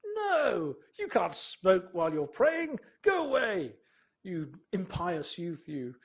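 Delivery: loud, high outraged scolding; hammed-up furious monk yelling at a novice; stern head monk scolding. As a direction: thunder at the young monk. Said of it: "you can sort of imagine the head monk".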